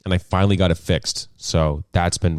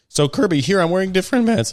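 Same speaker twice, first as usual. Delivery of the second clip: real voice crack; 12 semitones higher than usual. Real, not acted. Telling Kirby about the different pants, voice cracking. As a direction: voice jumps up high and cracks. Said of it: "I had a voice crack".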